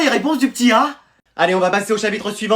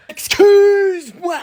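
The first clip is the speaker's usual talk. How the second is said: very high, drawn-out exclamation; exaggerated apology; 11 semitones higher, 3 dB louder, much slower than usual. Parent woken by late child, exaggerated high apology. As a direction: stretch it slowly at a soaring pitch.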